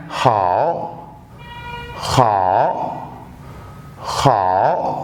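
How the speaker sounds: hao said low with a dip, Mandarin third tone; Chinese tone demo. Shown as a demonstration. Teacher modelling हाओ for students, low dipping tone. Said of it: "अगला लफ्ज है".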